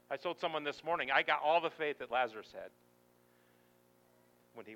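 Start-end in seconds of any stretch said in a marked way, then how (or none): none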